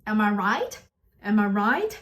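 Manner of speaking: "Am I right?" climbing on RIGHT; rising intonation. Shown as a demonstration. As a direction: end RIGHT on an upward question. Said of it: "that's a rising intonation".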